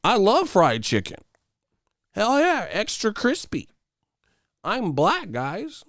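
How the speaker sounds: high, eager put-on voice. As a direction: gush like a high-pitched phony.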